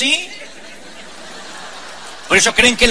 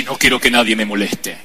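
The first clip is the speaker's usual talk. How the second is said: much lower, hollow preacher voice; solemn visiting preacher; 11 semitones lower than usual. Retold or imitated. Preacher shutting himself away, low solemn voice. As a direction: speak low like an untouchable holy man.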